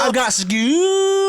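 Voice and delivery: loud, very drawn-out high boast; hammed-up high voice, last word stretched long; very high mock voice. Host repeating the bit bigger, very high drawn-out voice.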